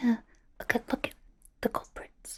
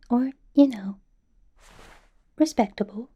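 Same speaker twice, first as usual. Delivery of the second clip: usual speaking voice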